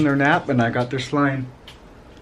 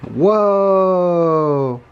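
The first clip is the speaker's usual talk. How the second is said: drawn-out, louder whoa that falls; surprised whoa; 6 semitones higher, 4 dB louder than usual. Real, not acted. Dad reacting as the kids grab the slime, amazed. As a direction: let the whoa stretch out in amazement.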